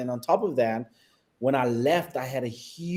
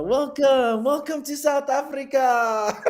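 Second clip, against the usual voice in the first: high, drawn-out calling greeting; exuberant welcome; 12 semitones higher, slower than usual. Retold or imitated. South African greeter hailing arrivals home, high exuberant mock call.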